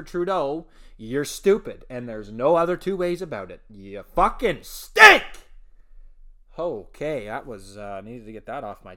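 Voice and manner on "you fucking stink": loud, slow furious yell; angry yell. Acted. Host ranting at the prime minister, loud angry yell. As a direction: lean in and yell the insult.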